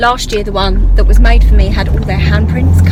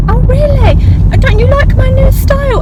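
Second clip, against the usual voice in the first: high, louder, playful mock surprise; 4 dB louder than usual. Teacher teasing a little girl about her ponytail, high mock surprise. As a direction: tease the pupil with big pretend shock. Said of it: "And I was like".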